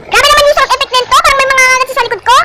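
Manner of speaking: scared. Real, not acted. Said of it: "Takot na takot na ako sa sound effect".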